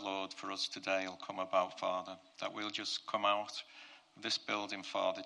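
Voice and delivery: ordinary delivery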